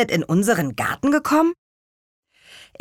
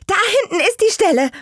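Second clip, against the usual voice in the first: called out much higher and fast; excited child calling out, higher and fast; eager shout to friends, much higher; 12 semitones higher, 4 dB louder, much faster than usual. Acted. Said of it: "rief Lea".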